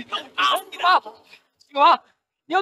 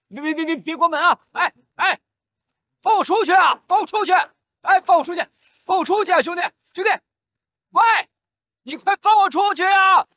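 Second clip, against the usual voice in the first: shouting for help; 9 semitones higher, faster than usual. Acted. Locked-up gambler screams for them to open the door. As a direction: pound the door and scream to be let out. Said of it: "别喊了，别喊了".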